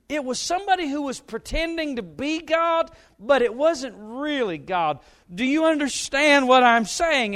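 put-on high, loud, flustered explaining to a kid. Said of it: "You're like".

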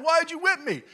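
high, loud tearful question; a sobbing child's outraged why; crying boy. Retold or imitated. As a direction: plead through tears like a whipped kid. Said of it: "I was crying".

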